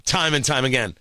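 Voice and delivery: ordinary talk, nothing marked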